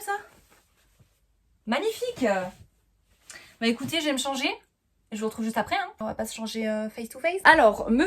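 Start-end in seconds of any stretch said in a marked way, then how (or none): 0.0-2.2 high excited praise; thrilled high magnifique; delighted. Real, not acted. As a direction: gush over the outfit, pitched way up. Admiring her matching outfit.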